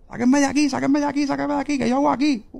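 high-pitched, frantic pleading at ordinary volume; retold desperate cry. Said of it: "empiezo a gritar".

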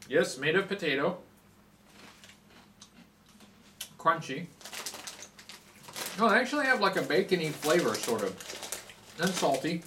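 eating between words; talking while chewing. Real, not acted. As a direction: mouth full of crunchy snack. Seen 0: hand feeds sticks to mouth, chewing with bulging cheeks throughout.

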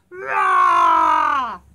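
huge shouted roar; very loud, long animal roar; lion roar. Pretend. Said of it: "Roar like a lion".

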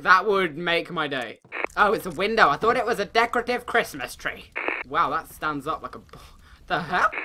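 usual speaking voice

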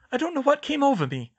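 high, loud, mortified plea; horrified apology. A narrator's reading. Hermione apologizing to her headmaster, high mortified voice. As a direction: blurt it, ashamed and shaken. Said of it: "she gasped".

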